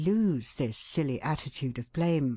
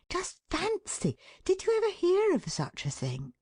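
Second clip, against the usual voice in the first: high mock disbelief; mock astonishment. A narrator's reading. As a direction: voice leaps way up in amazement. Mock astonishment, pitch soars.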